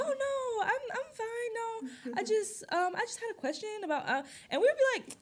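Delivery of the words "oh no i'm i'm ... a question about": very high, hammed-up polite caller; mom's phone voice. Daughter mocking mom on a work call, very high polite phone voice. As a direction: exaggerate a sugary professional phone voice. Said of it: "Why is she talking like that on the phone".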